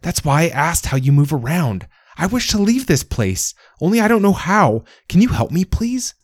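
eager rush. A narrator's reading. Little plant begging the penguin for help, eager and rushed. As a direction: beg the fairy before losing nerve. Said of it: "The words came in a rush".

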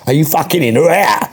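pushy Cockney demand; Cockney film director. Acted. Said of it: "Can you do your Cockney film director?".

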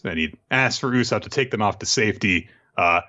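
usual speaking voice

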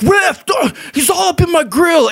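mock a sore loser; whiny opponent. Basketball opponent complaining to ref, high whiny voice. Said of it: "he's like".

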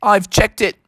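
high, hammed-up Aussie kangaroo; Australian accent. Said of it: "your Australian accent sure is awesome".

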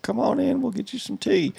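high, soft sweet Southern welcome; mock Southern hospitality, sweetly high and soft; Southern hostess. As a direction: play the syrupy hostess inviting you in. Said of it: "I don't mean, like".

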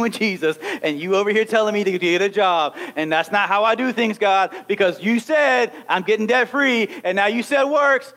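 ordinary talk, nothing marked

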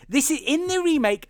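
usual speaking voice

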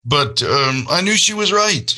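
holding it together through real emotion; choked up. Real, not acted. Dad admitting his daughter was right, voice breaking. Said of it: "I don't know why I'm so mo-, emotional now".

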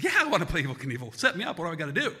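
put-on loud, excited kid saying yes; eager kid. Eight-year-old answers his brother's dare, eager and excited. Young self eagerly agrees to play.

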